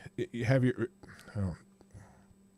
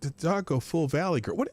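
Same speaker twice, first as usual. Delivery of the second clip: ordinary delivery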